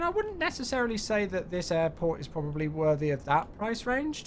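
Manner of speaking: normal talking voice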